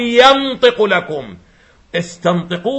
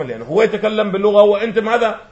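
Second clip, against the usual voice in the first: ordinary delivery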